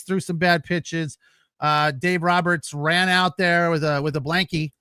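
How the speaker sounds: normal talking voice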